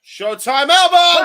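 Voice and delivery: loud, slow excited call; shouting. Real, not acted. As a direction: bellow the move name. Co-commentator calls the move.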